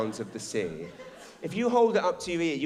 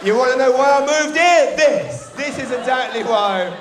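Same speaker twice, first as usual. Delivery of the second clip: much louder and higher, shouting the point; triumphant shout; 10 semitones higher, 10 dB louder than usual. Real, not acted. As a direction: proclaim it to the whole room.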